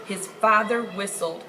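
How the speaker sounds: ordinary delivery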